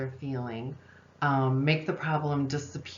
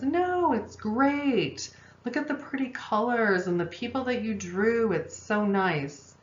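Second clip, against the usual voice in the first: much higher, bright mock mom praise; gushing mom; 5 semitones higher than usual. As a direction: gush over the drawing in a high sing-song mom voice. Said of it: "And the mom says".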